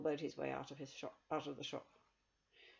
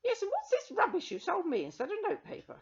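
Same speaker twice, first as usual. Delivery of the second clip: high, piercing complaint; cheated woman shrieking at the witch; shrill woman; 9 dB louder than usual. Acted. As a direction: make it a loud, high screech. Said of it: "said a shrill voice".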